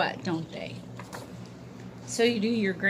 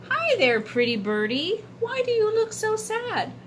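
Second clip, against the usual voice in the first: put-on young boy voice; 13 semitones higher than usual. Boy's voice in story.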